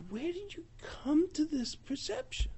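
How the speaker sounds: slow, hushed rebuke; intense whisper. Acted. Shmuel confronting the people, slow intense whisper. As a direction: whisper it like a stern secret.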